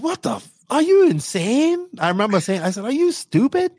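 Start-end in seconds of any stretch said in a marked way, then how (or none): 0.0-1.9 high, drawn-out mock disbelief; incredulous outburst. Retold shocked reply to weekly demand. 2.9-3.7 very high-pitched mock disbelief; incredulous question. Retold shocked reply to weekly demand.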